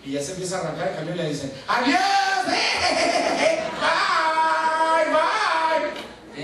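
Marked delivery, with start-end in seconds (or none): 1.7-6.4 very high, loud sing-song bye-bye with a laugh; mocking singsong farewell; taunting goodbye. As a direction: tease him as the bus pulls away. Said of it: "En mi cara se ríen".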